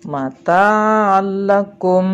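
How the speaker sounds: Mata'allakum loud and drawn out, long doubled l; merged reading. Shown as a demonstration. Teacher gives pupils another idgham example, merged recitation. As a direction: recite Mata'allakum slowly, holding the l with no hum. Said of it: "cara membacanya adalah lebur tanpa dengung".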